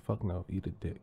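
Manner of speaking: normal talking voice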